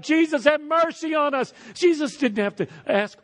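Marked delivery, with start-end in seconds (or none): 0.0-1.5 crying out. Acted. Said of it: "And they cry out".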